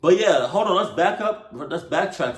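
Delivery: excited interjection. Real, not acted. Sudden loud interjection.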